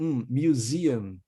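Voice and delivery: Um, museum said slowly, ending stressed like um; slow pronunciation model. Shown as a demonstration. Teacher shows students museum ends like um, slowly. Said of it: "é como se fosse um no final".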